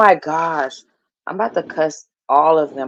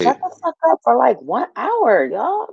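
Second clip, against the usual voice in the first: much higher, exasperated shout; yelling at family; 7 semitones higher than usual. Real, not acted. To relatives making noise, a high exasperated shout. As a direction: blast it across the room.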